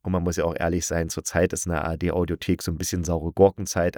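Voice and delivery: ordinary talk, nothing marked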